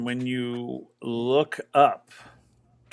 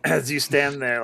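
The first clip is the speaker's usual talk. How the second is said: ordinary talk, nothing marked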